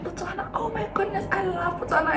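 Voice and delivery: high, soft mock gushing; imitated gushing delight. Manager gushes on hearing the country.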